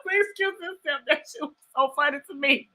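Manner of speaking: soft words through leftover laughter; telling the viewers, talking through giggles; laughing. Real, not acted. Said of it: "I cried laughing".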